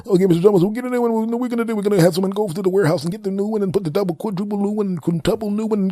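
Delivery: high, rambling excuse-making rep voice; high mock customer service voice; babbling company rep. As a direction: pile up nonsense excuses cheerfully.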